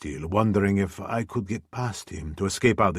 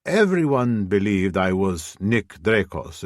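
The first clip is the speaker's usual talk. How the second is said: plain reading voice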